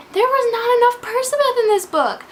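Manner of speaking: high-pitched, impassioned fan complaint; exasperated protest. Real, not acted. Fan protesting the lack of her favourite couple, exasperated. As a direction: wail it as a grievance.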